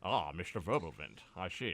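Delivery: low, quiet villain purr; Bond villain voice. Pretend. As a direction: greet him like a smug villain. Villain voice for Wirbelwind.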